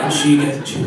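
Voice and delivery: ordinary delivery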